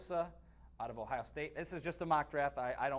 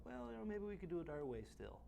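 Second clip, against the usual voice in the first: mock owner voice; 6 semitones higher, 7 dB quieter than usual. Mimicking owners rationalizing.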